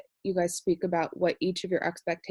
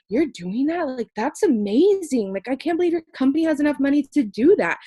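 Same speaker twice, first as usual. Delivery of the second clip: high, loud amazed praise; 7 semitones higher, 6 dB louder than usual. Retold or imitated. The girl reacting to the meal campaign, high and amazed. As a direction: marvel at the company.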